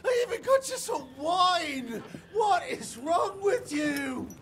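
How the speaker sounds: high, frantic yelling, half laughing and half crying; shrill desperate captive shouting; panicked shouting. Acted. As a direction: scream at them in hysterical outrage. Chester tied up, screaming at them.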